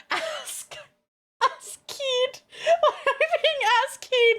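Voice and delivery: high, squeaky words through laughter; laughing incredulity. Real, not acted. Streamer giggling at chat's odd wording, high laughing disbelief. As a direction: crack up while asking it. Cracks up at misread chat word.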